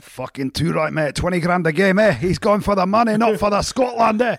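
Brian Redpath. Retold or imitated. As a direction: bellow it like a proud, rowdy rugby dad. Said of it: "And Brian Redpath went".

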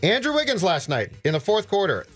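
ordinary talk, nothing marked

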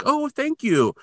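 very high, sweetly grateful voice; grateful little lady. Retold or imitated. Tiny woman thanks man carrying her fern, high and grateful. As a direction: pitch it way up, mock-delighted thanks.

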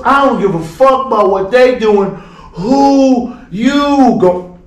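loud, high, defiant taunt; acted-out drunk challenge; belligerent dismissal. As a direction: cut in loudly, stretching the final words. Drunk player cuts him off.

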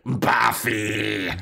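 lightly put-on taunting call; hint of a puppet voice; mock dummy voice. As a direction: tease her with a drawn-out name. Voicing Xander's dummy taunting Buffy.

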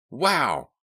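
high, loud burst of delight; jubilant exclamation. A narrator's reading. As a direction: let out an excited, marveling cry. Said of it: "Mickey proclaimed jubilantly".

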